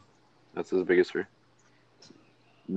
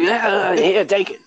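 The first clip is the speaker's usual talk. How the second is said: mock hobo voice; 11 semitones higher, slower than usual. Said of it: "He's like".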